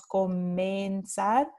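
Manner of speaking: comenzar drawn out slowly, syllable by syllable; slow stress demo. Shown as a demonstration. Teacher to students showing where the stress falls, slow and deliberate. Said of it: "Este tipo de cambio ocurre en una sílaba tónica".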